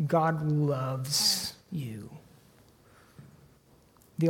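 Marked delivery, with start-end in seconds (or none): 0.1-2.1 tender reassurance. Acted. Pastor telling hurting hearers, slow and tender. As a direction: lay it down gently, word by word. Pastor giving God's answer.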